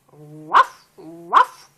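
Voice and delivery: loud, low barking woof; dog bark. Acted. Reading to a toddler, a loud low dog bark. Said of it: "barks the dog".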